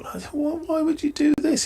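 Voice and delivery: high, slow, incredulous why; baffled at the captain's choice; rising disbelief at a strange field; exasperated disbelief. Real, not acted. Puzzled by the field setting.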